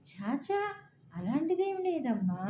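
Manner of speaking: lightly acted grandmotherly denial; dismissive reassurance. A narrator's reading. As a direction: brush off a child's worry. Grandmother hastily denies child's fear.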